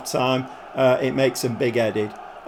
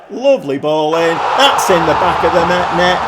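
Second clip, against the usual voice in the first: loud celebrating call; excited goal shout; 5 dB louder than usual. Real, not acted. Player celebrating a goal, excited shout.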